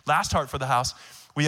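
ordinary delivery

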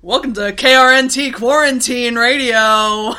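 loud, hammed-up radio announcer. Host doing a stupid show opening for co-host, radio voice. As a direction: belt out a silly station ident like a DJ. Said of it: "you don't like the radio voice".

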